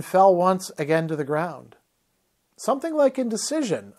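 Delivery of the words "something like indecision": cool, detached high remark. A narrator's reading. Stranger coolly greets the fallen Paran, detached. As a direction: say it coolly, unbothered. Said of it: "a voice said coolly".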